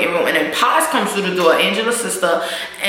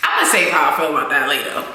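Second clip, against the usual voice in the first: ordinary talk, nothing marked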